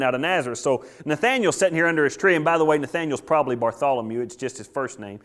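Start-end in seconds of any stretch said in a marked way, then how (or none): none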